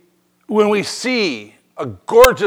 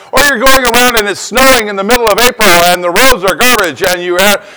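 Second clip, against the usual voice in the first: ordinary talk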